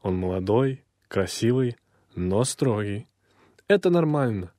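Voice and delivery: ordinary talk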